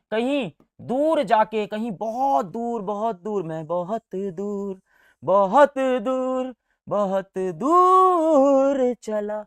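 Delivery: slow, stretched and rising bahut door; drawn out far away. Shown as a demonstration. Teacher showing how far the lines go, slow and stretched. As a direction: drag out every door further and further.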